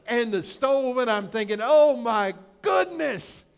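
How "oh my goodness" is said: loud, slow, higher exasperated cry; fed-up groan at the spilled soup. Retold or imitated. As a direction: let it out like you've had it.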